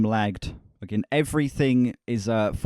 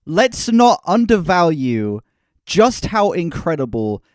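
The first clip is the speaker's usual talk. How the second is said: raised, slowed pleading; pleading rant; 6 semitones higher, 7 dB louder, slower than usual. Real, not acted. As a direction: beg the listener to see sense.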